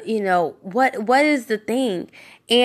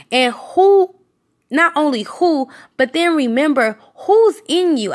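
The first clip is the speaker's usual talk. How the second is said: higher and a bit louder; raised preaching; 6 semitones higher, 3 dB louder than usual. Acted. Preacher urging listeners to remember who's in them. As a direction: lift the voice to drive it home.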